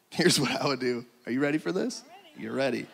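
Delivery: hushed, low, secretive aside; conspiratorial hush. Pretend. Speaker lets the crowd in on an off-handout plan, conspiratorial hush. Confides an off-handout snack scheme.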